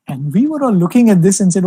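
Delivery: ordinary talk, nothing marked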